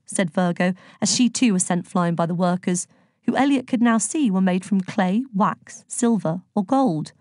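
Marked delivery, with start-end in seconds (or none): none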